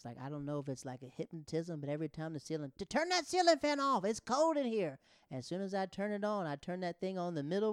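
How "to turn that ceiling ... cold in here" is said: high, loud mock nagging; shouted complaint. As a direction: holler like an annoyed older woman in a comic impression. Older woman complaining, acted out.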